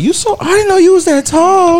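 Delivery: very high mock-girl voice; girly voice. Mocking women who meet him, very high girly voice. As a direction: squeak it like an impressed girl. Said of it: "I love when they be like".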